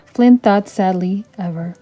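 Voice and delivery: usual speaking voice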